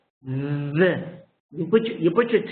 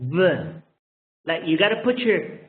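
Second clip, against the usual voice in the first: ordinary talk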